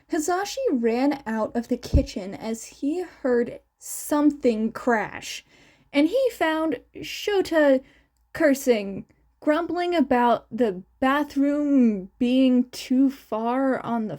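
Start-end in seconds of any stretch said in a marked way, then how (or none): none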